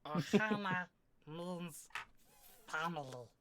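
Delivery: slow cartoon alien creature voice; halting, word-by-word creature impression; odd little monster voice, slow; Stitch. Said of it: "Sounded like Stitch on Helium".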